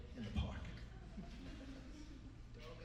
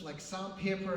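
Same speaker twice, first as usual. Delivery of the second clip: normal talking voice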